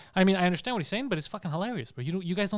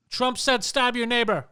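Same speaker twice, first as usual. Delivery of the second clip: slow, higher, louder mock news headline; mock headline shout; 6 semitones higher, 5 dB louder, slower than usual. Mocking the media's invented story, loud mock headline. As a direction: ham up a made-up headline.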